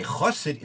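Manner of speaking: Chassid said as KHOSS-id; stress on first syllable. Shown as a demonstration. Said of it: "can also be pronounced".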